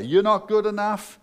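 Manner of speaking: shouted voice of the devil; devil's taunting yell. Acted. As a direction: sneer it down at them like a bully. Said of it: "The devil wants to yell and scream in your ear".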